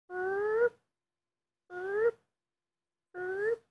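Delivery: high squeaky burp, burp, burp; playful squeak at the dog; squeaky boop noises. Pretend. Booping the dog's nose.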